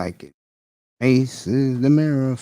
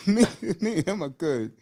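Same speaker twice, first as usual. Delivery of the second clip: laughing; 7 semitones higher than usual. Real, not acted. Laughing while reassuring about friend.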